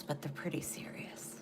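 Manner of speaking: whispered aside; whispered serious aside. Real, not acted. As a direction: drop to a whisper for emphasis.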